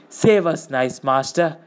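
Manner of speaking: wheedling, whiny appeal; whining plea. A narrator's reading. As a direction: whine it, starting high and loud, then easing down. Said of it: "he whined".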